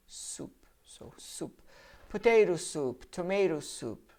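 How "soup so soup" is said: soft aside. Shown as a demonstration. Teacher quietly repeating soup after spelling it, softly. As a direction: softly breathe out "Soup, so soup", almost voiceless. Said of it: "be careful here".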